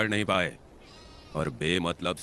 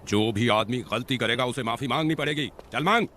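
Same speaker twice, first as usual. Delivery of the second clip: mounting insistence; stern demand; 4 semitones higher than usual. Acted. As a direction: lay down the law, building to a sharp order. Demands an apology from the offender.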